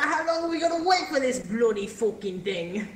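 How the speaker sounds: put-on British accent. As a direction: moan about the wait like a cheeky Brit. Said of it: "then I'm doing a British accent".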